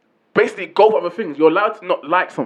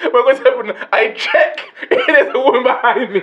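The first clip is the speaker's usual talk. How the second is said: high-pitched, breaking into laughter; higher voice, laughing through the words; laughing mockery; 7 semitones higher than usual. Real, not acted. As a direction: crack up while quoting the joke. Mocking the slip while cracking up.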